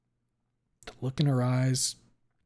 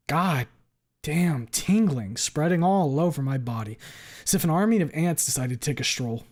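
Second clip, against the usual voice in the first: higher, emphatic angry narration; seething anger; 3 semitones higher, 4 dB louder than usual. Acted. Narrator so angry they feel nothing, seething. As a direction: lean hard on the key words, pitch swooping up.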